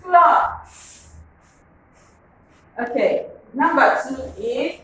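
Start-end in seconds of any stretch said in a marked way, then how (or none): 0.0-1.1 cloth said high and clear for the class; model word. Shown as a demonstration. Teacher modelling a word for pupils, high and clear. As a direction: pitch "cloth" up and hold it clearly.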